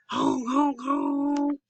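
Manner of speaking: high, drawn-out honks; horn honks. Acted. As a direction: Rex honking to clear the road. Long loud clear honk.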